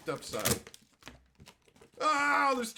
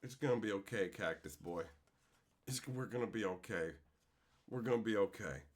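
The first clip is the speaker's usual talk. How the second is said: much softer and lower, comforting a plushie; soft reassurance; 13 semitones lower, 9 dB quieter than usual. Real, not acted. Consoling Cactus Boy the plushie, soft and low. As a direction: soothe it gently.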